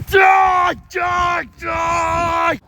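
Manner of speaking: high, loud shouts of "die"; yelled battle cry. Acted. Hunter shouting while shooting the dinosaur. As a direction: scream it as you shoot.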